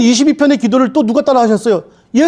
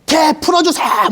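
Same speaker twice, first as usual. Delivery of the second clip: demanding God; 8 semitones higher, slower than usual. Acted. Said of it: "막 그런단 말이에요".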